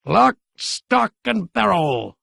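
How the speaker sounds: a hint of a triumphant crow. A narrator's reading. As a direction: savor the victory. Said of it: "said Gramp triumphantly".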